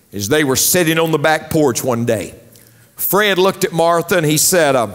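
ordinary talk

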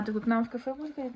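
normal talking voice

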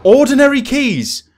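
loud, high, drawn-out cry; excited shout. Real, not acted. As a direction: blurt it out in triumph. Finds keys, bursts out.

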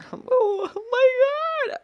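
high squealed laugh on the words; slow, very high giggling wail; helpless laughter. Real, not acted. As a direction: squeal it out slowly through laughter. Freezes in game, laughs helplessly.

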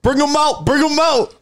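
high, loud call to bring them. Real, not acted. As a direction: hype them up, yelling. Calling for the bottles.

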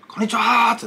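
high, drawn-out hello; loud greeting. Retold or imitated. Retelling the old man's loud hello at the door. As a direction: call out a hearty hello. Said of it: "ってでっかい声で".

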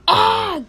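high, loud "ah" scream; loud play-fight yell; pretend battle yell. Sending cats to kill Battle Cats, pretend yell.

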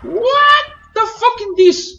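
slow, high, loud disbelief; stunned outburst. Real, not acted. Gamer reacting to the game, stunned outburst. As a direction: drag out each word in a high, loud cry.

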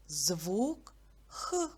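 Х breathed out as a long rough throat hiss; lone sound Х demonstrated for children; sound Х shown. Shown as a demonstration.

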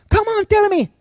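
high coaxing plea; pleading pastor. Retold or imitated. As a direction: gently beg her to tell. Pastor coaxing crying woman.

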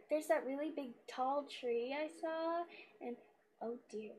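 ordinary delivery

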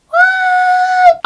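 very high, loud drawn-out shriek; piercing mock-shocked screech; shocked squeal. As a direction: squeal it in comic disbelief. Acts out shock at food invitation.

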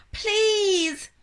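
high, loud desperate please; begging. A narrator's reading. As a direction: plead with all your heart. Sophie begging.

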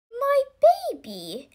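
high-pitched storybook cry of alarm; acted alarm. A narrator's reading. Missus Clutterbuck sees her baby on the pavement, acted alarm. Mother's baby nearly run over.

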